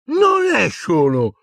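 high, slow call from above; acted shout across the room. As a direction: cry out high, drawing the words out. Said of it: "gridò una voce".

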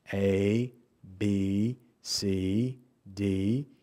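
low, soft, spaced-out letters; kindergarten teacher. Retold or imitated. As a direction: recite each letter gently as to small children.

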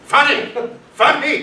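high, repeated outraged "Funny?"; indignant disbelief. Acted. Police chief echoing the detective's joke in disbelief. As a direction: throw the word back, appalled. Incredulous echo of colleague.

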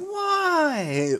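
high, loud, weary why; fed-up mom sighing out the word; exasperated mother. Acted. Mother answering her shouting daughter, exasperated. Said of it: "Like, very exasperated, um, mother tones".

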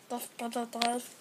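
usual speaking voice

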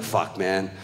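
low, quiet grumble; disgruntled dog voice. Retold or imitated. Head dog looking back over his shoulder, low grumble. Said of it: "Renee was like".

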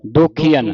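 ਦੋਖੀਆਂ with long ee, then a short "an"; long EE after KH. Shown as a demonstration. Teacher models the bihari for a student, ਦੋਖੀਆਂ as "dokhee-an". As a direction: say ਦੋਖੀਆਂ as "do-khee-an". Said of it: "ਖੱਖੇ ਨੂੰ ਬਿਹਾਰੀ ਬਣ ਜਾਣੀ ਹੈ".

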